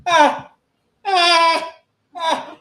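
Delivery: high, loud bleats of "ah"; imitated wordless cries for help; helpless calls. Comic too high to roll a joint, bleating helplessly. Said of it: "I started going".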